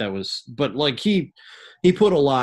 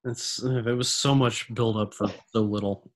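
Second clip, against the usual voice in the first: usual speaking voice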